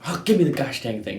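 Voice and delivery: snatch it like an impatient dad; imitating dad's exasperation. Son imitating his frustrated dad grabbing the rifle, fast mock grumble.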